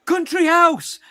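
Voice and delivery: high, loud shouted song title; shouting. Acted. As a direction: yell it out mid-run. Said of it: "after shouting".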